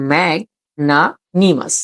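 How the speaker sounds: slow "ম্যাগনানিমাস" in pieces, mag, na, nimas; "ম্যাগনানিমাস" read out slowly, chunk by chunk; split into syllables. Shown as a demonstration. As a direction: sound out "ম্যাগনানিমাস" with clear gaps between the parts. Teacher sounds out new word.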